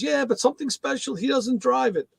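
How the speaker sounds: put-on high light Jamaican accent; imitating the mailman's Jamaican lilt. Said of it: "So he got this Jamaican accent".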